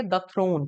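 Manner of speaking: द with a short, neutral vowel before throne; "thuh" before a consonant. Shown as a demonstration. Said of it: "वो द का sound निकलेगा".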